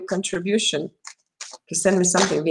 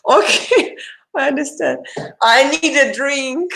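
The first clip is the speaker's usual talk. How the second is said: laughing; 6 semitones higher than usual. Real, not acted. Jokes about being tired.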